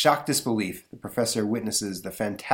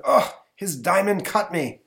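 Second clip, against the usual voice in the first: pained cry; 9 semitones higher, 6 dB louder, slower than usual. Acted. Villain cut while falling.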